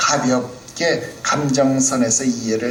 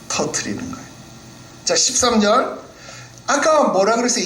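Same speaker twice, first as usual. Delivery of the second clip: usual speaking voice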